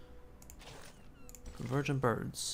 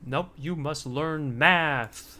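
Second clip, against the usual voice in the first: slow stern scolding; 7 dB louder, much slower than usual. Pretend. Scolds game character about math.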